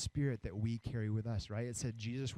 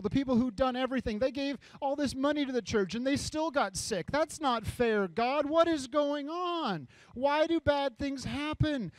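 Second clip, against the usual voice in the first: high, loud outcry at God; acted outrage, pitched way up; indignant protest; 12 semitones higher, 5 dB louder than usual. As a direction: build to an anguished demand. Voices outraged doubters of God.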